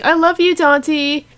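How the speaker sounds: high, loud swooning declaration; gushing love cry. Acted. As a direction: squeal it out adoringly.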